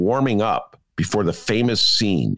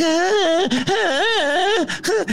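high, wailing mock cries of terror; hammed-up horror-movie wail; mock Shelley Duvall scream; much slower than usual. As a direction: shriek like the cornered wife in the bathroom. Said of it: "And Shelley Duvall's like".

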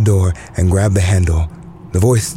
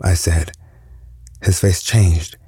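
ordinary delivery, nothing marked

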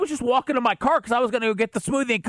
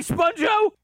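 shout; 11 semitones higher than usual. Real, not acted. Shouting a nickname at friend.

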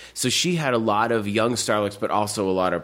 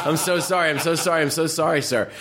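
higher, hurried mock apology; fawning repeated sorry; apologetic waiter; 7 semitones higher than usual. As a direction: grovel like a clumsy server. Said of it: "He's like".

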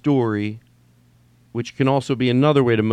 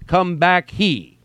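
mocking the misreader; 7 semitones higher, 4 dB louder, much slower than usual. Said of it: "you would be".

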